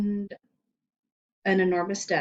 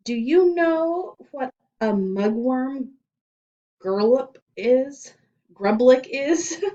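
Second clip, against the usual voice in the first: loud, slow, drawn-out menace; slow loud dramatic question; ominous reveal; 7 semitones higher, 9 dB louder, slower than usual. Acted. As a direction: savor each strange word. Principal names mysterious crime.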